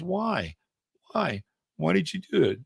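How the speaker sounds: softly pleading, a touch of hurt; mildly acted bewildered question, on the quiet side; lightly voiced hurt frog. Stung frog asks the scorpion why, mild hurt disbelief.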